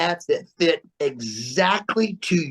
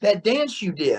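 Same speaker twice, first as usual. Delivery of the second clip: imitating [name]; 7 semitones higher than usual. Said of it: "He goes".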